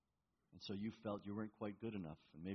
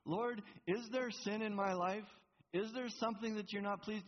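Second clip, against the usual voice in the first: honest plea; 10 semitones higher, 5 dB louder than usual. Shown as a demonstration. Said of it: "Do you see the difference?".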